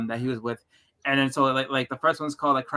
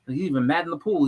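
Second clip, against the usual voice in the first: normal talking voice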